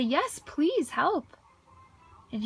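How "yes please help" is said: slow, higher pleading for help. Retold or imitated. Sick man begging the stranger for help, slow and pleading. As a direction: drag out a desperate plea. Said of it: "And I say".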